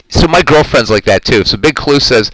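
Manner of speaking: ordinary talk, nothing marked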